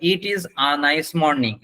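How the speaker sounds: very slow, each word spelled out for learners; slow demo sentence. Shown as a demonstration. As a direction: read it out slowly like a teacher. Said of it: "যেমন".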